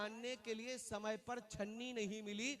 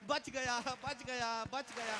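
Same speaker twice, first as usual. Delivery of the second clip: relieved cheer; slower than usual. Retold or imitated. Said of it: "तो मैं उछल के कहता".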